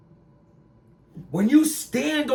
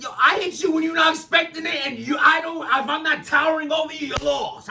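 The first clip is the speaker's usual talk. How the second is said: heated shout; 8 semitones higher, faster than usual. Retold or imitated. Heated retelling of the fight.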